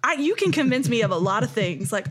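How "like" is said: ordinary talk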